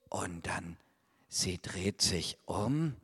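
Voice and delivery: suspenseful whisper. Acted. Storyteller hushes children before the voice. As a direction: drop to a whisper.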